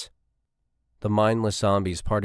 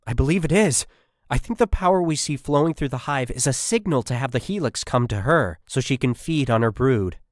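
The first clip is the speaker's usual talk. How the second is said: much higher and louder, words tumbling out; excited blurting; 6 semitones higher, 4 dB louder than usual. Acted. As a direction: she can hardly contain herself. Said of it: "Miranda's words blurted out in excitement".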